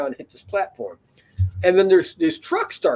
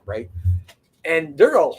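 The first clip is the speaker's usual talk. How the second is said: ordinary delivery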